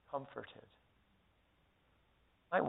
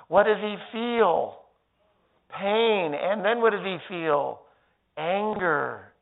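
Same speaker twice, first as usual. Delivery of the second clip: preaching at full force; 4 semitones higher, 11 dB louder than usual. Acted. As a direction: drive the point home to the congregation.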